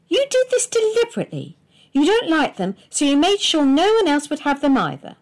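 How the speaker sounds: high, loud, fast tirade; a high, loud, rushed furious charge; angry accusation. A narrator's reading. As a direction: rattle it off at him in a fury. Wife accuses husband of sabotage.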